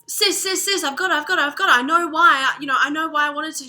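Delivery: high, loud and breathless with news; excited calling out. Acted. Mel phoning her sister with big news, thrilled and loud. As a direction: rush it out, bursting to tell her.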